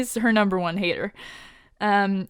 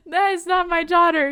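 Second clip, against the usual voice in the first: higher, louder cry of protest; outraged cry; 9 semitones higher, 4 dB louder than usual. Retold or imitated. Father shouting that the hero is not his daughter, loud cry. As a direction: burst out with it, half laughing. Father sees impostor through binoculars.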